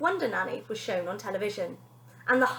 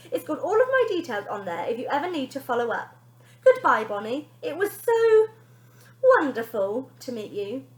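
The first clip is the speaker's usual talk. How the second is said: Wonder Nanny voice; 3 semitones higher, 6 dB louder than usual. Acted. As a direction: play the sugary celebrity nanny.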